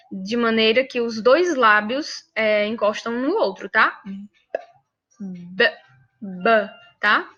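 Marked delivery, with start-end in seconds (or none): 5.2-6.7 B said with both lips pressed together; bilabial b. Shown as a demonstration. Teacher to Brazilian students, showing the bilabial b. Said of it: "tanto V quanto B em espanhol tem essa pronúncia".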